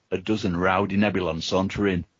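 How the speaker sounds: ordinary talk, nothing marked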